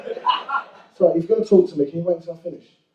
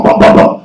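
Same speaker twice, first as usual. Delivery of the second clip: banging sounds; 4 dB louder than usual. Retold or imitated. Imitating banging on door.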